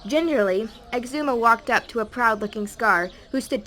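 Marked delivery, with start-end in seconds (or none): none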